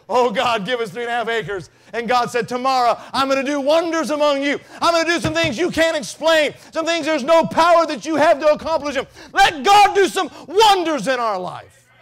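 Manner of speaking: loud, high preacher's shout; full-force preaching. Acted. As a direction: preach it out to the church.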